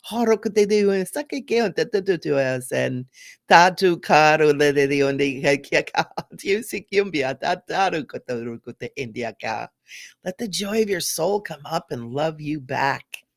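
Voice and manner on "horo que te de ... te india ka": free babbled glossolalia; speaking in tongues. Shown as a demonstration. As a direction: let nonsense syllables bubble out. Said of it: "whatever wants to bubble up".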